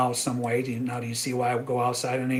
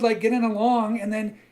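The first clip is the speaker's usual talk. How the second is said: ordinary talk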